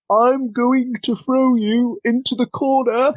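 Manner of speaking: high, drawn-out hammy announcement of a move; over-the-top wrestling call. Retold or imitated. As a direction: call the move slowly and absurdly obviously. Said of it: "they over the top call it".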